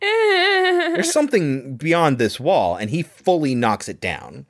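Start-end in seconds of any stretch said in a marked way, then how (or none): 0.0-2.8 sudden high urgent realization; excited man voice. Retold or imitated. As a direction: voice him urgently, pitched way up. Voicing Brolin continued.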